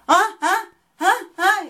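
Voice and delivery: high, loud yelping ahs; hammed-up dental pain howls; mock pained cries. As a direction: cry out like the drill hurts. Patient screaming at dentist.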